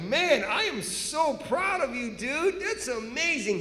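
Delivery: gushing admiration. Retold or imitated. Gushing praise to secret service man.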